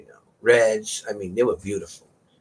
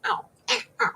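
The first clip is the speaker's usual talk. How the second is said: imitated meow; 12 semitones higher, 5 dB quieter than usual. Shown as a demonstration.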